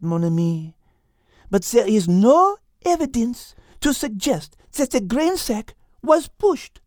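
high French cat voice, gently reasoning; Liz, French accent. Acted. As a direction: reassure him in a French accent. Liz's voice.

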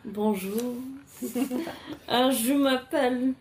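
put-on French accent. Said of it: "I am the French one".

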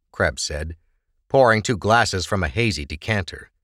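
ordinary delivery, nothing marked

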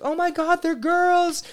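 very high squealing mock of the crowd; excited squeal. Family at the gender reveal, squealing very high. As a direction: squeal it like the whole party at once. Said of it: "everyone was like".

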